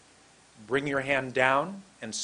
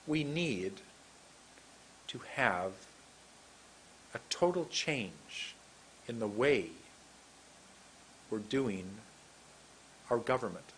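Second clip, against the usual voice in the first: Obama; 4 semitones lower, 7 dB quieter than usual. Acted. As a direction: think before each soft, halting phrase. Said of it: "we love Obama".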